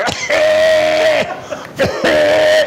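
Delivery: a loud, high burst of laughter; big high laughing shriek. Real, not acted. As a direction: howl with laughter at the memory. Remembering aunt's laughter.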